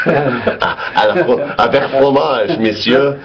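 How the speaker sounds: hammed-up French waiter accent, slow; mock French accent, drawn out; french chef. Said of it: "That's the only line I know from a Steve Martin movie".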